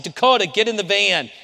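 high, loud pleading order; desperate shout. Retold or imitated. Pastor shouting at Dakota to get into the van, high and loud. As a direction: call him back with everything. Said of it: "I said".

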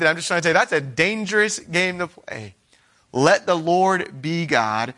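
ordinary talk

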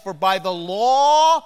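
loud, drawn-out proclamation; emphatic preaching. Real, not acted. Preacher quoting Romans to the congregation, loud and slow. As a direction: stretch each key word and hammer it home. Preacher hammering home a quoted verse.

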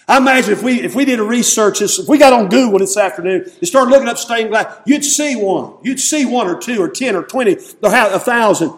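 high, loud pulpit delivery; animated preaching. Real, not acted. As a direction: build the count with rising fervour. Preaches with raised voice.

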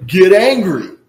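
loud barked "Get angry"; forceful command. Retold or imitated. Preacher voicing Paul's command to the church, loud. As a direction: command them like God would. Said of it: "He's telling them".

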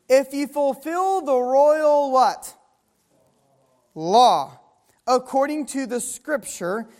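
ordinary talk, nothing marked

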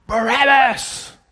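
high, loud, slow mob shout of Barabbas; crowd shouting. Acted. Preacher voicing the crowd before Pilate, a loud high shout. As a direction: bellow the name like a mob demanding it.

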